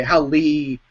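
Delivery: ordinary talk, nothing marked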